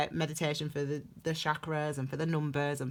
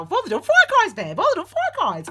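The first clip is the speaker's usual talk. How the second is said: much higher, louder, laughing cry; amused exclamation; 14 semitones higher, 11 dB louder, faster than usual. Real, not acted. To viewers, realising she has read only four coins, laughing exclamation. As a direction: squeal it, amused at herself. Laughs at her own digression.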